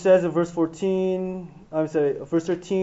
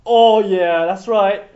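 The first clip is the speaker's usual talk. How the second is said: loud, swaggering brag; cocky bragging; 10 dB louder, much slower than usual. Retold or imitated. Peter gloats to the disciples about the keys, loud and cocky. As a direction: show off to the other disciples. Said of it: "he's just feeling like".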